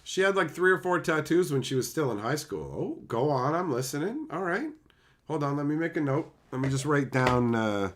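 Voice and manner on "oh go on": ordinary talk, nothing marked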